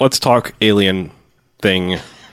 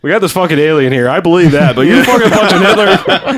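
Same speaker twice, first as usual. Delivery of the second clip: incredulous; 7 semitones higher, 4 dB louder than usual. Retold or imitated. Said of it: "Everyone's like".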